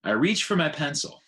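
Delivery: ordinary delivery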